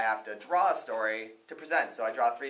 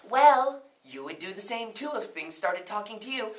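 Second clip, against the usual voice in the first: lightly voiced talking net; 8 semitones higher, 4 dB louder than usual. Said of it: "his net looked at him and said".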